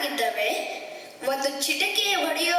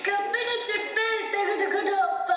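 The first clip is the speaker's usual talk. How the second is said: put-on whining child; 3 semitones higher than usual. Birbal as the child pesters the king, sing-song whine. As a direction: beg like a spoilt little kid. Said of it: "ನೀನು ಮಗುವಿನಂತೆ ನಟನೆ ಮಾಡು".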